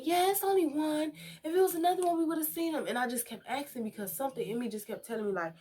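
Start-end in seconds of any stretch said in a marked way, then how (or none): 0.0-2.9 high, breezy voice for the doctors; doctors' dismissive reply. Retold or imitated. Doctors insisting to a worried patient, breezy and high. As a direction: brush it off like a doctor who's sure. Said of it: "they kept saying".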